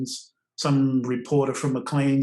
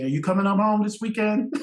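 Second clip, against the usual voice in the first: higher-pitched lilting invitation call; imitating Mame; 6 semitones higher than usual. Mame phoning to invite the speaker for the weekend, imitated. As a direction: voice a hostess's eager phone question, higher. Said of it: "So Mame would call me".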